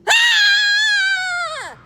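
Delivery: sudden high piercing scream; startled scream. Acted. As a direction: scream as the trap snaps shut. Trap jerks them upward.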